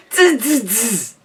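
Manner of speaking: sharp hissed monkey chatter; monkey tss-tss. Acted. Monkeys mimic the peddler back, hissing tss-tss. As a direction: hiss three short mocking tsses. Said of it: "shook their hands back at him and said".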